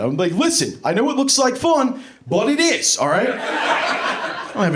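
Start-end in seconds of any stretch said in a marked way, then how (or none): 0.3-3.3 much higher, chirpy fatherly excuse; high-pitched mock dad reassurance; sheepish dad voice. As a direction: brush off the kid in a high bright voice. Dad voice to kid.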